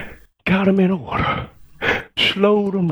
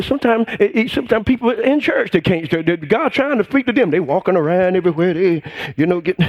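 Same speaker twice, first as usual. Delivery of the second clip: ordinary talk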